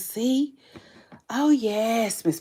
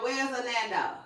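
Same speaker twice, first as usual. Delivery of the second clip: ordinary delivery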